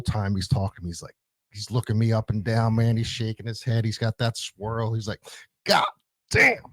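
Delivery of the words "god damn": drill sergeant exclamation. Retold or imitated. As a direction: shout a disgusted "God damn" like a drill instructor. Drill sergeant reacting to tattoos.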